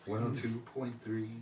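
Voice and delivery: low, soft late-night DJ; sleepy radio voice. Acted. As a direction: murmur it like a sleepy radio host. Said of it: "The sleepy radio voice".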